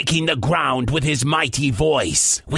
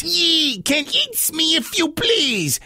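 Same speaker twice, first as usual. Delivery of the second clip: higher pirate voice, wheedling; pleading pirate; 10 semitones higher than usual. Acted. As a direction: bargain with the giant as a pirate. Pete bargains with giant.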